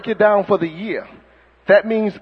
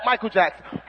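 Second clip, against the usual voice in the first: ordinary talk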